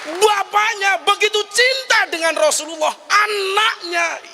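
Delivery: high-pitched, sustained preacher's cry; impassioned cry. Real, not acted. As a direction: cry it out high and loud, drawing out the last word. Preacher's impassioned cry about love.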